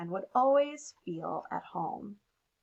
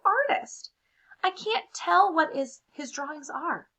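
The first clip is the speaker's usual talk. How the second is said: higher, louder put-upon crayon voice; fed-up toddler crayon; 6 semitones higher, 5 dB louder than usual. Acted. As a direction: vent like a worn-out crayon. Toddler crayon complains in letter.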